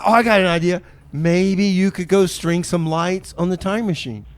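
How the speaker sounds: ordinary delivery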